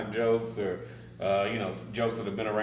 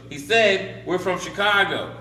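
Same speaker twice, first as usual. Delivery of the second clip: raised, higher voice for poor hearing; husband loudly relaying the words; repeating for deaf wife; 9 semitones higher, 5 dB louder, slower than usual. Acted. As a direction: project it loudly to someone hard of hearing.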